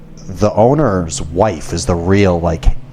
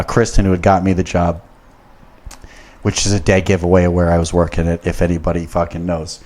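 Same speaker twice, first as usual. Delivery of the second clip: usual speaking voice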